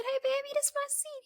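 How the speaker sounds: put-on high, soft baby-talk voice; sweet-talking a child. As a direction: coo it up high, as to a little kid. Sweet-talking a child in retold scene.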